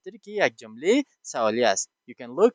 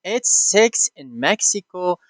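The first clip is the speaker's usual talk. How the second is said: slow and louder, six stressed; slow model reading; 5 semitones higher, 9 dB louder, slower than usual. Shown as a demonstration. Teacher reading the sentence out before translating. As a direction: read it slowly, hitting six. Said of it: "I'm gonna read and translate them".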